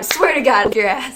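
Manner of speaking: retold screaming. Upset festival-goer screams back at megaphone heckler, retold yell. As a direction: yell back in outrage, re-enacting it. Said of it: "screaming the whole time".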